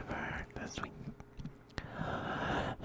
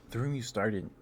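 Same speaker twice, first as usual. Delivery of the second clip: ordinary talk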